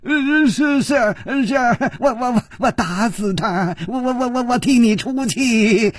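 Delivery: high, stammering, eager to please; cowardly stammer. A narrator's reading. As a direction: stutter out a bluff promise. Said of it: "他说". (结结巴巴的高声讨好)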